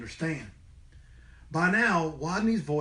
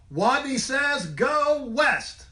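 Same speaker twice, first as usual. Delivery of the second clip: high, loud confident call; strong call; 9 semitones higher, 4 dB louder, much slower than usual. Acted. Little rat now calling commands boldly to the class. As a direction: call it out with full force. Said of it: "In a voice so strong".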